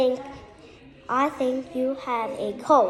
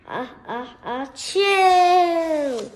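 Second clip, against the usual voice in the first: building ah then a higher, louder chew; hammed-up sneeze, high and loud; fake sneeze; 4 semitones higher, 4 dB louder, slower than usual. Child acting out the elephant's sneeze.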